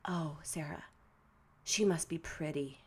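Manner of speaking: hushed grandma quote. Retold or imitated. Sarah quotes grandma admiring the girlfriend, hushed. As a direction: lightly imitate an impressed grandma, soft and unhurried.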